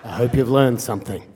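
low-pitched serious father; stern dad. Retold or imitated. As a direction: imitate a stern dad's low lecturing. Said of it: "this very serious, stern".

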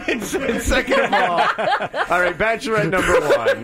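laughing game show host; flirty teasing dating host. Acted. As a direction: tease the contestants while laughing.

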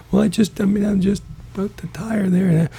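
high-pitched, stammering excuse; flustered stranded driver. Retold or imitated. Stranded driver explaining himself to the cops, high and hesitant. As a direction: play a caught-out motorist in a high voice.

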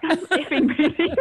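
laughing. Retold or imitated. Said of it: "Can I swear, by the way?".

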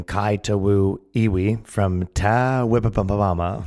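slow, stumbling try at "Kai Tawu Iwi from Tawipapampama"; fumbled name. Real, not acted. Reading out a name he admits he can't pronounce, slow and unsure. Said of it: "can't pronounce the name".

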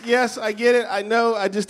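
ordinary talk

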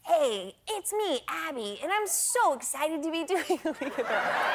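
playful high-pitched friend impression; Abby. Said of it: "I'd love your Abby impression".

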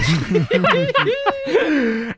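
high, breaking laugh mid-story; burst of laughter. Real, not acted. Host laughing while retelling story.

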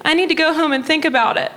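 high, quick mom impression; put-on high mom voice; mom's voice. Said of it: "She goes".

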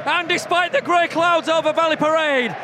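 mock-solemn commentator flourish; grand commentator speech. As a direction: lay it on like a stirring broadcast speech. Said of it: "Don't ruin my speech".

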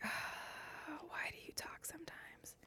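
slow, barely audible sighing whisper; self-reproaching whisper. Acted. Host catching herself rambling, self-reproaching whisper. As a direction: sigh and whisper it very slowly to yourself. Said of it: "you catch yourself and you're just like".

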